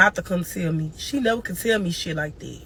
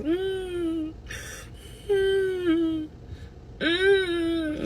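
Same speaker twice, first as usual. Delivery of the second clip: crying moan; 10 semitones higher, 7 dB quieter, much slower than usual. Retold or imitated. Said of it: "crying, moaning".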